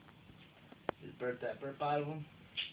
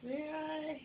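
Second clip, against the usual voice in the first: much higher, sing-song coaxing; 13 semitones higher than usual. Real, not acted. Coaxing baby to greet camera.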